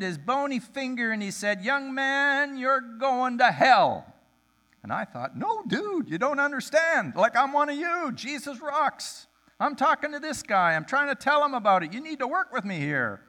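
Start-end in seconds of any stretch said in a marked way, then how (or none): 1.6-4.1 high, loud, slow condemnation; angry preacher. Retold or imitated. Street preacher condemning the narrator, angry. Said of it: "he's one of those angry types". 5.4-13.2 high, urgent protest, fast in places; exasperated pleading. Retold or imitated. Narrator pleading with the angry preacher, exasperated.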